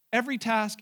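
normal talking voice

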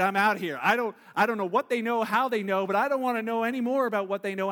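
loud, hurried mock getaway; mock panicked exit. Person fleeing someone who knows secrets, loud mock panic. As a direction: blurt it out as if bolting, loudly. Pretend escape from exposed secrets.

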